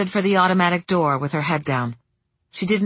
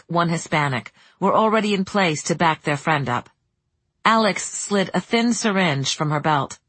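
ordinary delivery, nothing marked

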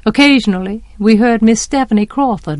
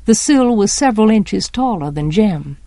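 plain reading voice

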